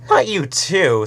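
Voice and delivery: high whiny complaint; whining. A narrator's reading. Sunset whining to Twilight about secrets. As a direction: whine it, drawn out and petulant. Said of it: "Sunset whined".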